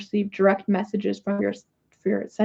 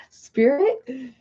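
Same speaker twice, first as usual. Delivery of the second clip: usual speaking voice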